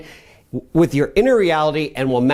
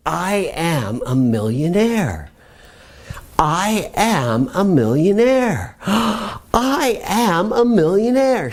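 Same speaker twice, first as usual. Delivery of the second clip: slow, building up to a gasping, higher thrill; with feeling and emotion; 3 semitones higher, much slower than usual. Shown as a demonstration. Coach shows the class how to say it with feeling. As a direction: say it like you truly feel it, slowly. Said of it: "say it with feeling and emotion".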